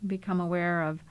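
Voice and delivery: calm, unhurried body-scan voice with long pauses; even, settled meditation delivery, leaving space to breathe; guided meditation. Acted. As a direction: guide them gently into the body, phrase by phrase.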